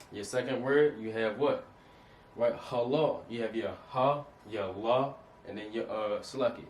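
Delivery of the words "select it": ordinary delivery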